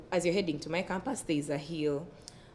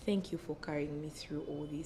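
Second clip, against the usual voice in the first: tender; 9 dB quieter than usual. Retold or imitated. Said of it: "I was telling my body".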